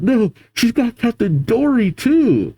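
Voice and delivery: high-pitched cry of alarm; dismayed call. Acted. Giants losing second captain, high dismayed call. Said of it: "The giants then call out".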